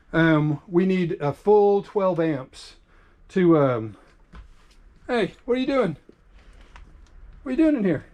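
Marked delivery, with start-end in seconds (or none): 5.1-8.0 playful surprise. Real, not acted. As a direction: sound delighted at a small intruder. Talking to child who walked in.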